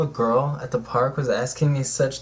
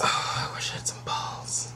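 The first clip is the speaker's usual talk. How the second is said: whisper; 7 dB quieter than usual. Real, not acted. Whispered joke.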